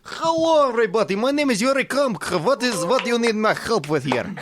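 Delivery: a hammed-up Russian accent. Introduced on air, Yuri greets everybody in a Russian accent. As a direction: Yuri Komkov greeting, thick mock Russian. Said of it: "let's bring in the Russian".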